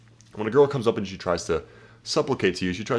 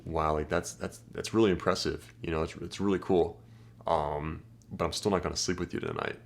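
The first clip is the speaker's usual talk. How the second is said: softer, flat mock praise; dry unimpressed text; 4 semitones lower, 4 dB quieter than usual. Shown as a demonstration. Guy texting a bragging girl, dry mock praise. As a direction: praise her brag with zero excitement. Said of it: "You just smirk at her".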